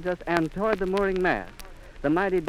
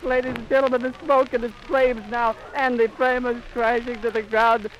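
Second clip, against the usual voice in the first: horrified shouting; 7 semitones higher than usual. Real, not acted. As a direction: shout it out in real horror. Reporting crash live in shock.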